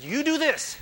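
loud, high, firm command; commanding God voice. Acted. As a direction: hand down the order like God. God gives Joshua his command.

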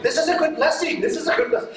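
high, laughing pastor counting money; delighted pastor. Retold or imitated. Pastor counting the offering, high and laughing.

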